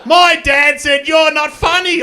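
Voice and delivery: slow, high, loud shouted jeer; loud drawn-out kid's taunt; heckling kid. Retold or imitated. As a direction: yell it out like a gloating brat.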